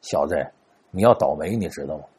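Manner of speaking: low, soft, confiding warning; a soft secret warning to the young man; hushed aside. Acted. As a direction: pull him close and say it under your breath. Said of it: "小声跟他说".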